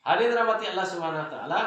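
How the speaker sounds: high, loud and fast address to the congregation; loud preaching call. Real, not acted. Preacher addressing the congregation, loud and fast. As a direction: call the gathering to attention with full force.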